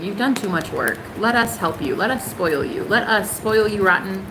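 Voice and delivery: usual speaking voice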